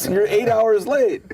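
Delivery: high, loud, slow; laughing exasperation; exasperated. Retold or imitated. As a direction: point out the absurdity. Nick scolds late Norm.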